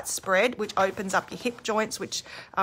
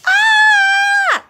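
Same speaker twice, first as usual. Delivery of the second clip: piercing shriek, much higher and louder; high-pitched scream; 14 dB louder than usual. Shown as a demonstration. Teacher demonstrating the wrong, high scream to viewers. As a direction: shriek from the top of the lungs. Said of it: "So screaming like".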